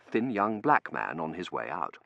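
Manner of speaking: plain reading voice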